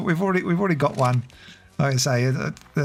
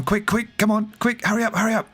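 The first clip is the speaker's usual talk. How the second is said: mildly urgent pleading, a touch higher; urgent hurry; 6 semitones higher than usual. Acted. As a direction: hurry him up with light urgency. Aussie hurrying bartender.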